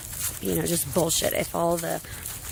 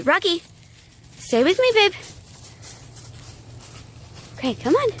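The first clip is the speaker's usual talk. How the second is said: calling the dog; 10 semitones higher than usual. Real, not acted. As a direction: coax the dog to stay near.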